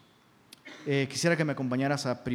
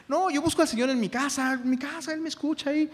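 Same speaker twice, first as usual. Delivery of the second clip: high-pitched mock protest; protesting believer; 9 semitones higher than usual. Pastor mimicking a believer who says he's fine, mock protesting.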